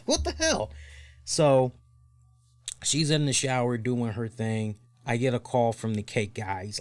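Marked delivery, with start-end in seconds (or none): 0.0-0.7 exasperated. Real, not acted. Exasperated outburst at wife.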